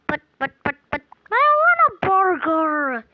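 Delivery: sputtering, then a high, loud wail; pretend angry tantrum. Customer refused a burger by the seller, wailing mock tantrum. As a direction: whine it loudly as a play-acted tantrum. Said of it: "And then she got angry".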